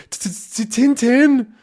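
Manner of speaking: high stammering fright on Tintin; scared stammer. Acted. Detectives stammering Tintin's name in fright. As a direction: stutter the name, terrified. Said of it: "they both say".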